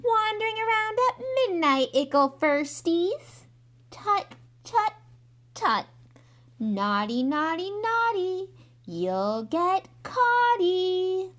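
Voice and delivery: high, loud, drawn-out teasing singsong; mischievous high-pitched mockery, stretching the words; cackling poltergeist. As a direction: taunt them like a gleeful imp. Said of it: "Peeves cackled".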